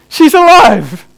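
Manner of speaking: a loud, high, overjoyed burst; she's alive, ringing out; joyful cry. Retold or imitated. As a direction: shout it out in sheer relief. Father hears his baby cry.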